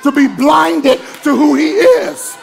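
fiery pulpit shouting; preaching at full force. Acted. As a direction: build the congregation to a roar.

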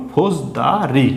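"ফোজ দা রি" in three stretched, separate beats; broken into syllables. Shown as a demonstration. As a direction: split "ফোজ দা রি" after ফোজ and after দা. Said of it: "কবার ভাঙলো?". ("ফোজ দা রি" তিনবার ভেঙে টেনে পড়া)

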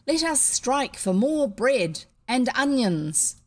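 drawn-out declamation; rousing orator. A narrator's reading. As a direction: proclaim it to a crowd of workers. Orator rousing the striking workers.

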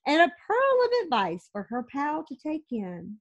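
lively storybook read-aloud; reading to children. A narrator's reading. Mom reading a picture book to her kids, lively read-aloud. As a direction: read it out to the kids with a sing-song lilt.

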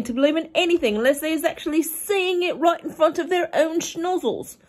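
high, loud giant insisting; the giant huffs that humans won't believe; indignant BFG. A narrator's reading.

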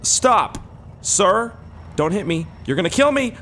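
high-pitched panicky yelps; alarmed calling out. Pretend. Player pleading with the oncoming bus, alarmed shouting. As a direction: yell for the driver to stop.

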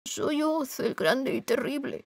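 a frightened little man; trembling voice. A narrator's reading. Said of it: "con voz temblona". (voz temblona, aguda y suave)

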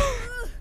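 soft, high giggle; laugh. Real, not acted. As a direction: giggle quietly at your own joke. Laughs after repeated warning.

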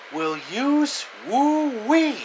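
high, slow squeaky cartoon yelp; cartoon catchphrase. Narrator quoting a cartoon friend, high cartoon yelp.

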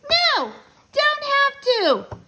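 put-on high, loud toddler defiance; slow, drawn-out bratty squeal; stubborn little kid. A narrator's reading.

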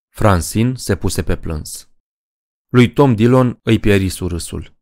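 ordinary delivery, nothing marked

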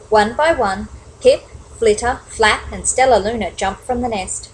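ordinary delivery

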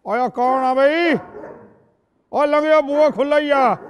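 slow, loud call back. Acted. Elder calls back from inside.

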